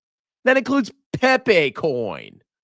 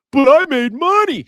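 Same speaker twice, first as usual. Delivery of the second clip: high, loud whiny scammer voice; mock whiny scammer; 10 semitones higher, 5 dB louder than usual. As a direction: whine it like a greedy scammer. Mocking a scammer.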